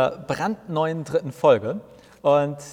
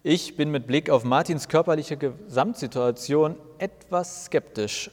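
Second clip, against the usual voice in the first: normal talking voice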